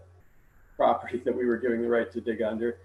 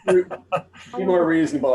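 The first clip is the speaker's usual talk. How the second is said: laughing; 5 semitones higher, 7 dB louder, slower than usual. Real, not acted. Jokes about legal term, laughing.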